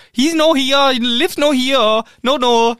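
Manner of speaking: put-on high, loud German-accented broken English; panicked woman fumbling her English. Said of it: "auf ganz schlechtem Englisch sagt sie".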